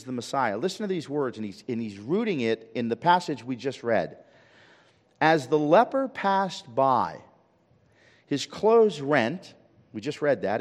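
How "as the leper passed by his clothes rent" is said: slow, raised, weighty recital; dramatic reading. Acted. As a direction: read it out loud and slowly, word by word.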